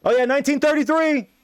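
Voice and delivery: eager prisoners chiming in. Retold or imitated. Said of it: "prisoners, like, in other jail cells are like".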